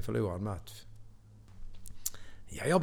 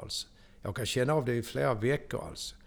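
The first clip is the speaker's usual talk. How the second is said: ordinary delivery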